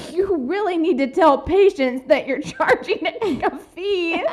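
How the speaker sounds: quoting Mom, laughing; laughing while quoting. Retold or imitated. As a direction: imitate Mom while cracking up.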